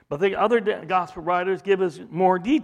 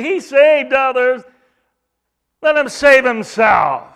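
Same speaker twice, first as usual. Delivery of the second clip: loud, higher scornful sneer; mocking; 6 semitones higher, 9 dB louder than usual. Priests sneering at Jesus on the cross, loud higher jeer. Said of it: "So they say".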